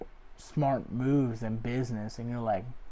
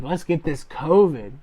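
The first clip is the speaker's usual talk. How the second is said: eager mock cheer; 4 semitones higher, 8 dB louder than usual. Eager plan in quarantine.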